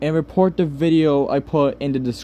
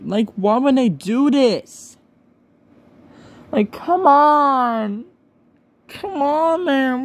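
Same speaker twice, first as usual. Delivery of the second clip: high, strained pleading whine; exasperated, near-tears high whine; distressed whine; 8 semitones higher, slower than usual. Real, not acted. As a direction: plead in real despair, voice cracking up high. Upset over fetish videos of him.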